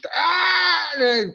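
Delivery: a high, loud imitated cry of pain; high yelp of pain from a cold drink, imitating the patient; mock pained cry. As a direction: act the patient's sudden twinge of sensitive tooth pain.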